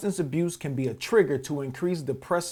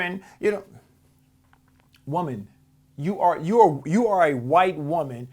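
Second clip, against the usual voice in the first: ordinary delivery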